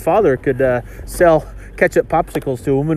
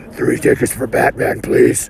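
gruff whispered Batman voice; 3 semitones lower than usual. Retold or imitated. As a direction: rasp it like Batman. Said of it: "speaking of superhero voice".

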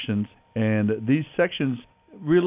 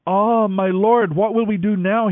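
frightened cry; 9 semitones higher, 5 dB louder than usual. A narrator's reading. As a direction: cry out in alarm to your master. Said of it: "he cried out to Elisha".

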